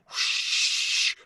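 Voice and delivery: long voiceless rush of wind; whoosh. Retold or imitated. Skydiver imitates the plane door opening, whoosh. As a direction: imitate air roaring in through a door.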